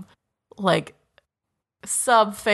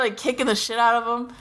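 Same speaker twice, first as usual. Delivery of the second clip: ordinary talk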